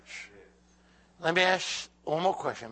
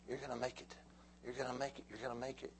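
soft reassurance; 14 dB quieter, faster than usual. Acted. Reassuring the congregation softly.

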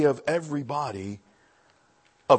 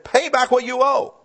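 high, loud, harsh demand; angry demand; 11 semitones higher, 7 dB louder than usual. Acted. Slave choking his fellow slave, demanding his money in anger. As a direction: bark the debt at him.

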